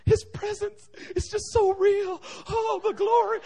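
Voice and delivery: high, slow, tearful imitation; hammed-up sobbing, overcome by glory; mock weeping worship. Wife overcome by God's presence in car, mock weeping worship. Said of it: "she's crying and weeping and worshiping".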